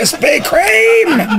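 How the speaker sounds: drawn-out high shout; slow, high hammed-up outcry. Repeats mock curse at donuts.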